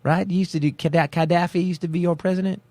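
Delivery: put-on Texan Southern accent; mock Southern accent. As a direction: brush them off in a hammed-up Southern accent. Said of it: "do an awesome Southern accent".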